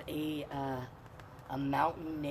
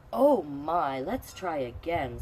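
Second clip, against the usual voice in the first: a hint of a patient teacher, louder; 8 dB louder, slower than usual. Teacher gently asking Red to try again, mild. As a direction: sigh it out kindly then slow. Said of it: "Scarlett, the teacher says".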